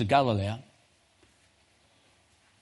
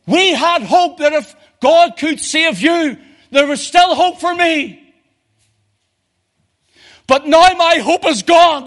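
anguished outcry; 13 semitones higher, 10 dB louder than usual. Retold or imitated. Paramilitaries' lament retold at full force.

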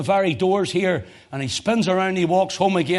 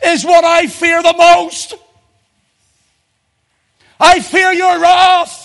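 much higher and louder, a desperate plea; anguished cry; 12 semitones higher, 11 dB louder than usual. Acted. Christ in Gethsemane crying to his Father, anguished and loud.

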